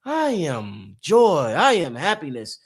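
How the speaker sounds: slow, calm affirmation, each word drawn out; reciting affirmations. Retold or imitated. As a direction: savour it like a mantra, very slowly. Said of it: "you just say".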